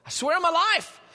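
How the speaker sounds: mock emphatic I swear on my life; emphatic oath. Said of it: "We've said that before".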